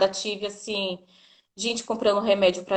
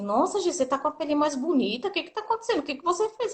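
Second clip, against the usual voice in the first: higher, lightly mimicked gushing coworkers; amazed coworkers; 6 semitones higher, 4 dB quieter, faster than usual. Coworkers marvel at Gi's glowing skin, higher mock amazement. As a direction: play the curious coworkers marvelling at her skin. Coworkers quizzing her, acted quote.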